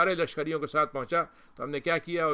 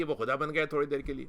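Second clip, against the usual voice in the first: ordinary delivery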